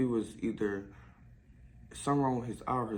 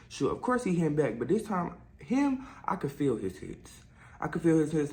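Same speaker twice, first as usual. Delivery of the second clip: normal talking voice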